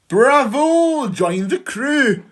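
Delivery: a big hearty welcome; cheering shout. A narrator's reading. Hyena and wildebeest welcome the vulture with a cheering shout. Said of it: "the other two shouted".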